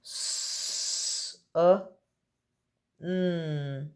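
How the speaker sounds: each letter sound said separately, with pauses between. Shown as a demonstration. Teacher to pupils, sounding out a word letter by letter. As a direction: split the word into its single sounds for the class. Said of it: "Sound out sun for me".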